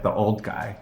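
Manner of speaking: ordinary talk, nothing marked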